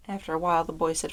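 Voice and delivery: usual speaking voice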